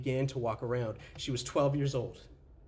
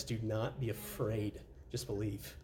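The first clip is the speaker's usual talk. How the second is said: slow, soft and lower; gentle reassurance; 5 semitones lower, 8 dB quieter, slower than usual. Acted. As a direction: say it tenderly, almost hushed. Jesus's words, soft gentle.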